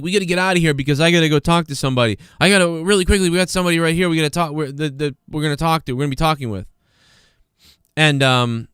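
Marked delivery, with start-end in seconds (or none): 0.0-6.7 rushed reporter. Retold or imitated. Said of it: "he was like".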